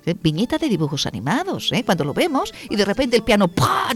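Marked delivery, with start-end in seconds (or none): 3.5-3.9 cartoon crash sound. Cartoon piano falling sound.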